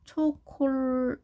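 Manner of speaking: "초콜릿" slowly, syllable by syllable as cho-kol-let; Korean-style pronunciation. Shown as a demonstration. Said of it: "우리가 어떻게 말하죠".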